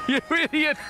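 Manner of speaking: high, amused teasing jab; laughing insult. Real, not acted. Teasing his mate after a laugh. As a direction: laugh it at him fondly.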